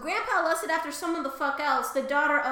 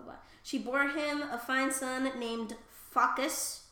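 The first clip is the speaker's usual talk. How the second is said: normal talking voice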